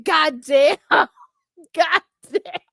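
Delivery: helpless laugh between the words; laughing disbelief. Real, not acted. Streamer reacting to a viewer's story, laughing. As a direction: gasp it out through laughter. Cracking up at crude joke.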